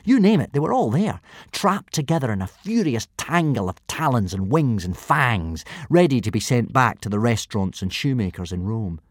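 ordinary delivery, nothing marked

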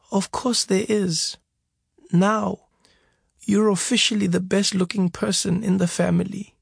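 wounded mother joking to cheer her son; teasing mother. A narrator's reading. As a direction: tease him lovingly. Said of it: "broke out in a huge smile".

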